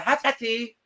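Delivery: a hammed-up imitation, way up high; mock foreign word. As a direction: toss off the word in a high teasing voice. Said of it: "She was like".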